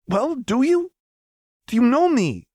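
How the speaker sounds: very high, loud desperate demand; desperate plea. Acted. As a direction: beg her to answer. Echo desperate.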